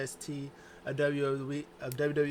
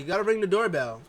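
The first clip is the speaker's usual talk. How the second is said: higher, louder, fast demand; Bayley hyped about the doorbell; excited insistence; 6 semitones higher, 9 dB louder, faster than usual. Retold or imitated. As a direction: rush the instruction eagerly. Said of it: "she's like".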